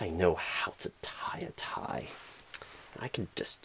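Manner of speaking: whispered self-reassurance under the breath; muttered to self. Acted. Interviewer fumbling his tie mutters to himself, whispering. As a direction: hush it, talking yourself into confidence.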